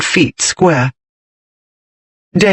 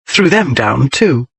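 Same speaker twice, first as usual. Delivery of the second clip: ordinary delivery, nothing marked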